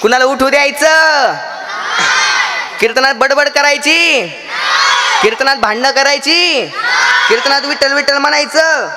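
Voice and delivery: high, drawn-out prompts to a crowd; calling out. Real, not acted. Preacher drills children on behaviour during kirtan, calling out.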